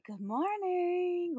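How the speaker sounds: sweetly overbright greeting; a sweetly bright good morning to an annoyed boss; happiest voice. Retold or imitated. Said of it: "in my happiest voice".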